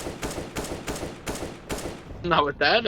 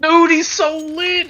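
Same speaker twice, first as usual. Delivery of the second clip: excited; 12 semitones higher, slower than usual. Real, not acted. Cheering a teammate's play.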